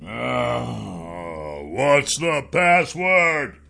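very slow, drawn-out orc guard; a dim guard getting suspicious. Acted. Orc growls demand.

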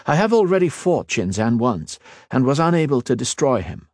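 a hint of a calm young lord; earnest mage, lightly voiced. Ethan explaining to the Queen, lightly voiced earnest.

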